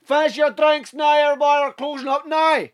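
strict closing-time call. Acted. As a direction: call time on the whole pub, mock-strict. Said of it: "how strict they are with fucking closing times".

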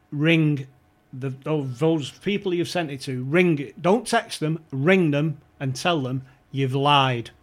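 loud stern orders, slow on the last line. Retold or imitated. Chairwoman ordering the scout to phone and retract, loud and stern. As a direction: lay down the law, biting each word.

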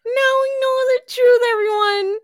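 high, wailing announcement; mock-tragic lament. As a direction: proclaim it in a theatrical fake cry. Dramatic mock lament after the reviews.

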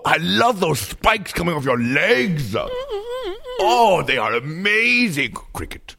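lightly voiced sassy diva, delighted with nature; a hint of a camp diva loving the outdoors. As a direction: purr it like a fierce diva. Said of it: "it is me, [name]".

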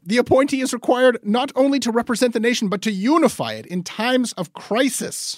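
high, loud, swooping pompous reading voice; grandly romantic reading. Pretend. Reading Coyne's monarchy column aloud to cohost, grandly romantic. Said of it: "I don't know why I'm doing this voice recording".

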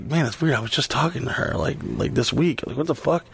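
puzzled under the breath; quiet puzzled. Retold or imitated. Confused muttered quote.

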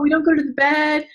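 normal talking voice

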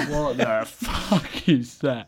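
slow, drawn-out bafflement; mock confusion, stretched slowly; baffled teammates. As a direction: drag it out like confused French players. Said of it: "They're like".

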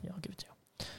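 whispered aside. Real, not acted. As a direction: breathe it under your breath. Muttered aside.

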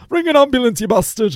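hammed-up [name] demanding an ambulance; [name] impression. Mocking his own plea as [name], brash and shrill-high. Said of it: "I sound like [name] there".

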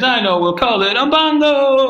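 mock rhino voice. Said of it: "The rhino says".

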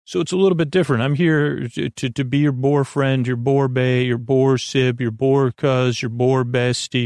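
ordinary talk, nothing marked

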